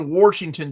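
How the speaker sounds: Washington as "WARSH-ington", Kentucky style; added R. Shown as a demonstration. Giving a popular Kentucky example, added-R pronunciation. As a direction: put an R in the first syllable of Washington. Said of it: "That's a very popular one".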